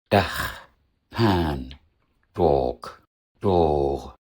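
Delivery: Dach short, then Pan, Dork and Dor with long vowels; vowel length contrast. Shown as a demonstration. Teacher asks learners to hear four lengths, contrasting demo words. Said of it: "Erkennst du die Unterschiede".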